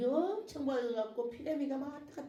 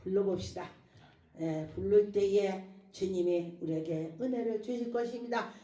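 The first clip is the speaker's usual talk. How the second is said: ordinary talk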